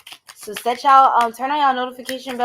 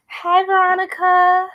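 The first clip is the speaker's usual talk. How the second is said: cheerful greeting; 10 semitones higher, 6 dB louder than usual. Real, not acted. Greeting a viewer in the chat.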